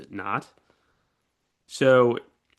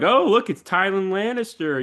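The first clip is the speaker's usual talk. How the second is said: high mock sneer at Tylan, a little louder; mocking soldiers; 10 semitones higher, 4 dB louder than usual. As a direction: jeer at him in a much higher voice. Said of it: "they're like".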